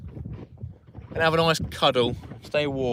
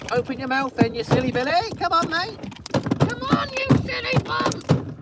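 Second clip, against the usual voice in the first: very high, cooing pet voice; 14 semitones higher than usual. Real, not acted. Coaxing hooked fish.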